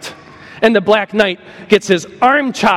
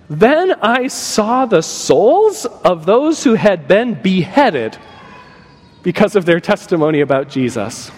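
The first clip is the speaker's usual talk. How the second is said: solemn scripture reading. Acted. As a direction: read it with weight.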